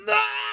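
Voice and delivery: high mock yelp of alarm; retold yelp. Host reenacting her yelp on seeing the unplugged mic, high mock yelp. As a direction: yelp in sudden horror at the mistake. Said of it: "I literally yelled".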